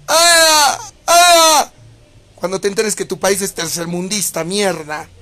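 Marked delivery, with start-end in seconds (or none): 0.1-1.7 high, loud, hammed-up crying shrieks; over-the-top fake sobbing squeals; mock frightened wailing. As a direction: wail like a scared crybaby to mock them. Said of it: "Eso deben gritar".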